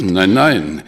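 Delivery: sudden loud no, no, cutting her off; alarmed protest. Acted. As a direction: stop her quickly, alarmed. Bischof wehrt erschrocken ab. (erschrockenes, lautes Abwehren)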